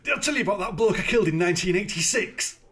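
put-on joining in the bit as the gleeful old killer; mock Kincaid boast. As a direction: brag about a killing like a fond memory. S1 voices Kincaid bragging.

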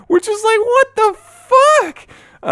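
very high squeaky disbelief; incredulous. Real, not acted. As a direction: squeal out the outrage. Outraged disbelief.

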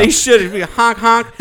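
high, loud honking clown; silly clown. Pretend. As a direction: honk like a clown horn. Silly honk noises for football.

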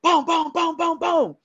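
high, steady string of booms; imitated gunshots. Shooter scaring things off the camp, imitated gunfire. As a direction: mimic firing off rounds. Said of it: "shoot off some rounds".

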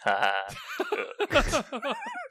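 big open laugh; hearty laugh. Acted. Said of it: "Zyber laughed".

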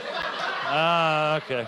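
ordinary talk, nothing marked

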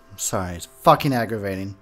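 normal talking voice

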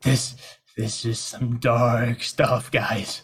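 low, slow and subdued; uneasy. Acted. Cornered prisoner objects to his captors, low and slow. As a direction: drag it out softly, dismayed.

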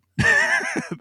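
sudden high burst of laughter; high, loud laugh bursting out. Real, not acted. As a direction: break into a real laugh. Laughing at his own story.

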